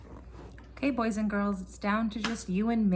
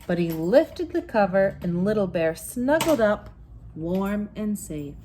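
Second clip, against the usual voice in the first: story narration; 7 dB louder, slower than usual. A narrator's reading. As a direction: tell it like a bedtime story to a child. Bedtime story narration continues.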